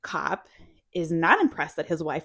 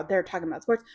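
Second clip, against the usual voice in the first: ordinary talk, nothing marked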